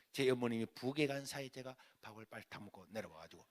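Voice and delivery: usual speaking voice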